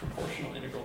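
whispering proportional integral to oneself; whispered. Real, not acted. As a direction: breathe proportional integral with no voice, very soft. Recalls the acronym under breath.

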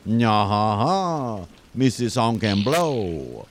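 put-on hammy French detective accent.

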